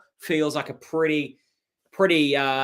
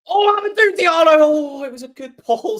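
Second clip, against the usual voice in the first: sudden high, loud yelp of excitement at the goal; a fan's real jump of delight; excited outburst; 10 dB louder than usual. Real, not acted. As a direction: burst out, caught off guard by the goal. Sudden goal during live chat.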